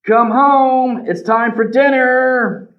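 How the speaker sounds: parents calling. Acted. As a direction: call across the woods for your son. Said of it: "his parents asked".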